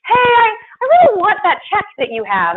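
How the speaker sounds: high, loud whiny demanding voice; whiny caller. Retold or imitated. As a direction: mimic a needy caller begging. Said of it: "getting phone calls".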